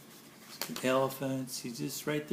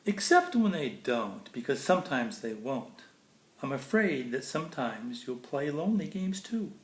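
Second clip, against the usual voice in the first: bright, lilting storybook read; sing-song picture book reading; reading to children; 5 dB louder than usual. Acted. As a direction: read it like bedtime verse for a child. Reads Dr. Seuss to kids.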